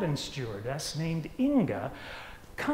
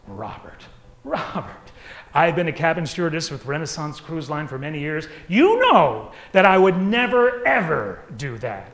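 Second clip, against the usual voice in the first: horrified; 8 dB louder than usual. Retold or imitated. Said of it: "She was horrified".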